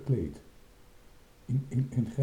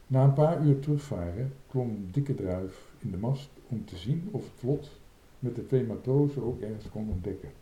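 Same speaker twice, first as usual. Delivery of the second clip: ordinary delivery, nothing marked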